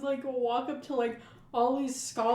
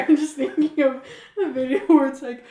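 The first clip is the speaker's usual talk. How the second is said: usual speaking voice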